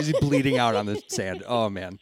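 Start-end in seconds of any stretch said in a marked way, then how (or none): none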